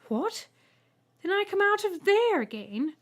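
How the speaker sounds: high, loud disbelieving question; can't believe what she hears; horrified disbelief. Acted. Ethel horrified at egg exit.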